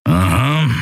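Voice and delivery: understated sceptical rumble; a doubtful grunted response; rumbled grumble. A narrator's reading. Keru dismissing the captain's answer, mildly rumbling. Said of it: "grollte Keru".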